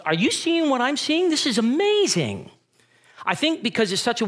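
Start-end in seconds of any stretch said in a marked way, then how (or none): 0.1-2.5 high, excited disbelief; amazed. Retold or imitated. Disciples in the boat watching Peter, amazed and excited. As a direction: nudge your friend, stunned by a miracle. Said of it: "the other disciples must have been going".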